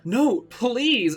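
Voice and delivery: high, loud desperate plea; begging. Acted. Ebony begging Voldemort not to make her, high and loud. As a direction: beg for mercy. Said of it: "I begged".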